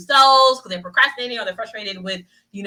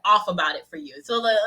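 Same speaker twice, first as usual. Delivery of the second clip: usual speaking voice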